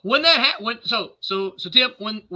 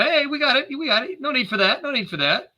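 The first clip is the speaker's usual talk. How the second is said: higher-pitched, hurried-in reassurance to stop him; heading someone off; 9 semitones higher than usual. Acted. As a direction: wave him off with a lifted voice.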